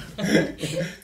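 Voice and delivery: loud, high laughter; laughing fit. Real, not acted. Colleagues amused by background dancing, loud high laughter. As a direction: keep cracking up, pitched high.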